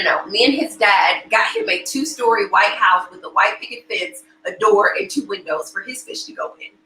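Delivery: usual speaking voice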